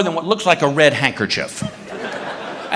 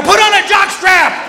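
high, exasperated shout; slow, high-pitched yell; yelling back; 13 semitones higher, 4 dB louder than usual. Retold or imitated. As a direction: holler it back indignantly.

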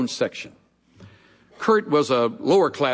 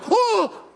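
pained yelp. Retold or imitated. Bullied kid hit in teeth.

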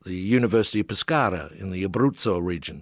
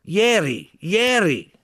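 much higher, louder triumphant Ieri; excited yell; 11 semitones higher, 5 dB louder, much slower than usual. A narrator's reading. Italian artist finally getting the word, excited yell. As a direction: shout it as the puzzle cracks. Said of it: "he yelled".

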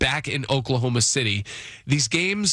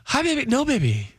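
much higher, cutesy couple voice; girlfriend voice; 11 semitones higher than usual. Retold or imitated. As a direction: imitate a guy cooing to his girlfriend. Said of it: "girlfriend voice where they go higher".

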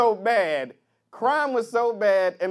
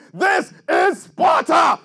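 slow, drawn-out shout, higher and louder; movie battle shout; 8 semitones higher, 7 dB louder, much slower than usual. Retold or imitated. Spartan king yelling in the movie, epic battle shout. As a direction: bellow it like a warrior king. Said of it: "some dude yelling".

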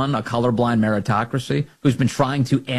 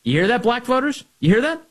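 calling out; 11 semitones higher than usual. Real, not acted.

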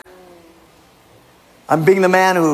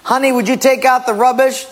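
high sweet wife voice; wife asking sweetly; 7 semitones higher than usual. Acted. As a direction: ask nicely like a sweet wife. Wife asks husband in skit.

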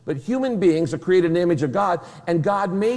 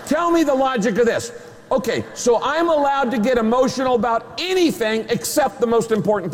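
fiery preaching; 5 semitones higher than usual. Acted. Pastor pressing the congregation, fired-up preaching. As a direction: challenge the crowd to explain the logic.

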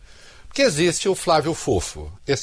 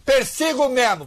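mock defiant declaration, pitched high; high, mildly hammed-up mimicry; Eduardo's defiant vow; 10 semitones higher than usual. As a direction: imitate a threat with light exaggeration. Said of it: "nós falamos sobre o Eduardo".

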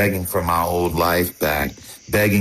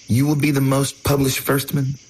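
higher, level quoted proclamation; mock-grand quote; 7 semitones higher than usual. Nephew mocking how someone talks, mock-grand. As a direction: quote it back, pitched up and incredulous. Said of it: "Who even talks like that?".

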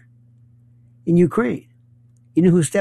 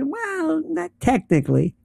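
mock hedging; 11 semitones higher than usual. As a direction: imitate someone wriggling out of it. Mimicking defenders who hedge.

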